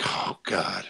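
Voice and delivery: breathy unvoiced "Oh, God" of dread; exasperated groan under breath. Dreading being sent to the bar, muttered under breath. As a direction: sigh it out like you already know it's trouble.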